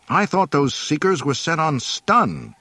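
loud, high, indignant complaint; angry exclamation. A narrator's reading. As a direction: protest angrily at the teacher. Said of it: "he exclaimed angrily".

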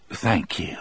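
soft unvoiced whisper; whispered thanks. A narrator's reading. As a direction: breathe the thanks. Hal moved, grateful.

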